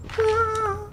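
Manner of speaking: tiny squeaky high "ah" falling away; Mario death sound. Retold or imitated. Imitating a stranger doing the Mario death sound, squeaky high. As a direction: chirp it like Mario dying. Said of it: "They do the Mario death sound".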